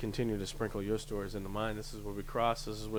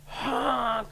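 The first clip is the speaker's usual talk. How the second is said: mock honk; 12 semitones higher, 3 dB louder than usual.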